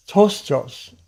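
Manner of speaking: "tostos" said as TOSS-tos with long o's, no P sound; "tostos" as two careful syllables, TOHS-tohs; careful Greek pronunciation. Shown as a demonstration. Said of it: "get the right pronunciation for it".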